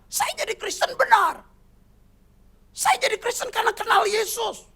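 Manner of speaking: high, loud defiant declaration of faith; defiant daughter. Retold or imitated. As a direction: stand firm and declare it to the family. Girl defends faith to angry family.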